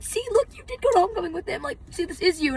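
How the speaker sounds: very high-pitched mock excited insistence; imitated insistent fans. Fans showing her proof it's her, high insistent imitation. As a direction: mimic a fan triumphantly presenting proof.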